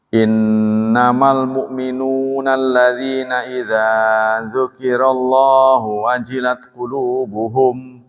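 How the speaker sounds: slow chanted Arabic recitation; Quran recitation. Acted. Preacher reciting the verse to the congregation, slow chant. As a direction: intone the verse slowly and reverently.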